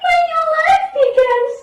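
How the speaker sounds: high, fast joyful cry; excited boy. Acted. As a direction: squeal with joy like a kid. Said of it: "and screamed".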